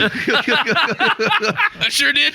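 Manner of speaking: high, drawn-out laughing admission; real helpless laughter. Real, not acted. Co-host admitting he trusted the waiter, cracking up. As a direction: squeeze the words out through high laughter.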